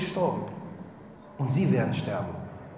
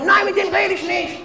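mock indignant old lady; 11 semitones higher than usual. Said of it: "old lady retorts in retold story".

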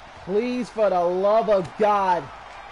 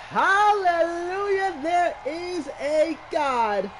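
jubilant shout; 8 semitones higher, slower than usual. Real, not acted. Celebrating the title win.

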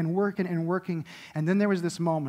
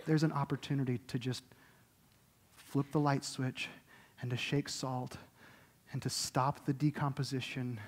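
much softer, low, intimate sermon voice; tender quiet preaching; 4 semitones lower, 7 dB quieter than usual. Acted. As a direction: speak it close, almost to one person.

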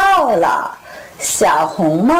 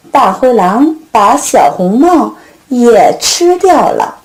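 ordinary delivery

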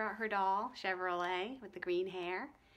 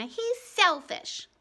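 slow, higher, louder accusation; accusing; 9 semitones higher, 9 dB louder, much slower than usual. A narrator's reading. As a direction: spit it out slowly. Ramona accuses.

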